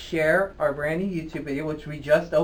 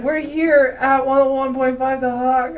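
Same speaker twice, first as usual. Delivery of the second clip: replaying his own on-air line, higher and louder; mock radio voice; 7 semitones higher, 7 dB louder than usual. Host quoting his own radio slip, mock announcer voice. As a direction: ham up the DJ line you flubbed. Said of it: "I can't believe I said".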